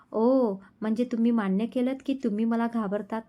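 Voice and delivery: ordinary delivery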